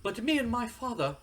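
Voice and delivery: high-pitched, hammed-up aristocrat talking down; haughty snob. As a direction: play a snooty prince pretending to apologize to peasants. Haughty wrestler addresses his former partners.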